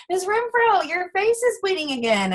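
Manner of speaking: high-pitched imitation of a third grader; pupil. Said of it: "So my kids would say".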